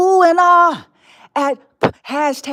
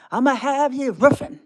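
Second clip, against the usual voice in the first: ordinary talk, nothing marked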